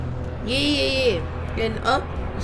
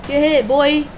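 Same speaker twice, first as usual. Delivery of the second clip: taunting shout; 4 semitones higher, 9 dB louder than usual. Real, not acted. Taunting crashing into bus.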